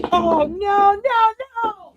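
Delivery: high, loud cry of dismay; real dismayed wail. Real, not acted. Host breaks her favorite mug, dismayed wail. Has just broken favourite mug.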